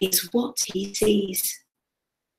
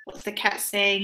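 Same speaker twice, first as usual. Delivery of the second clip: ordinary delivery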